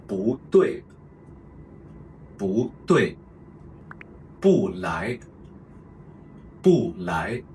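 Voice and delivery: each bu phrase twice, slowly; slow tone sandhi drills. Shown as a demonstration. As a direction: stretch each syllable so the tones show. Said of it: "let me go through all the examples".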